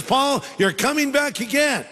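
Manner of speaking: high-pitched, playfully acted good news; upbeat announcement. The Lord gives Paul good news, mock upbeat announcement. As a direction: announce it brightly, pitched well up.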